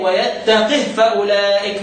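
ترتيل بطيء مع تسكين هاء "ويتقه"; كسر القاف والوقف بالسكون على هاء "ويتقه"; هاء ساكنة. Shown as a demonstration. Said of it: "روي عنه السكون". (اقرأ "ويتقه" بهاء ساكنة، على مهل)

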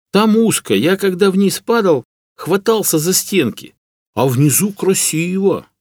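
high, slowing character voice; naive peasant. A narrator's reading. Hunter describes the crevice to rescuers, naive peasant. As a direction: recall it with simple wonder. Hunter recounts what he saw.

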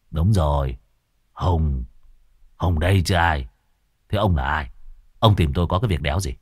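put-on low, surly young man; lightly voiced rough youth; low blunt questioning; Hùng's voice. Hùng answers rudely.

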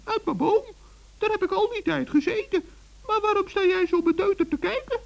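very high, chirpy little gnome; a tiny forest gnome, all innocence; high gnome voice teasing the witch. Acted.

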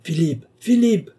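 high, loud, drawn-out call; old man shouting a name. A narrator's reading. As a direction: cry out for someone out of reach. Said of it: "cria le vieillard".

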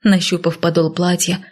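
plain reading voice

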